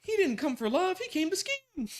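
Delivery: high, dramatic mock narrator; a show voice calling him a scammer; mock announcer. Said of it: "they say you're a scammer".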